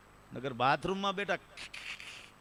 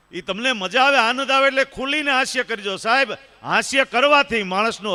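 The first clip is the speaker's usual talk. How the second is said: raised, higher voice rallying the crowd; booming encouragement from the stage; loud urging; 7 semitones higher, 11 dB louder than usual. Real, not acted. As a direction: call out to the whole hall to laugh freely. Urging audience to laugh loudly.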